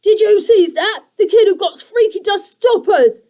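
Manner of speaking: high, loud panicked creature; a goblin in panic; panicked creaker. A narrator's reading. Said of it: "Guff mumbled in panic".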